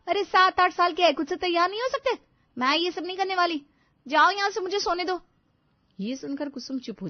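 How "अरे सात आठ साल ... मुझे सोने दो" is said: high, loud irritated retort; angry retort. Acted. Bride yelling at her mother-in-law to let her sleep, irritated. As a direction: shoo her off, fed up and loud.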